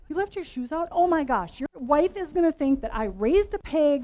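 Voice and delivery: mock mom rant. Mother imitating herself yelling at her kid about shoes, hammed-up exasperation. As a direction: scold like a mother at the end of her rope.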